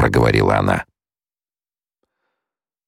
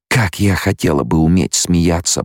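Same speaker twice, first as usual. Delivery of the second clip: usual speaking voice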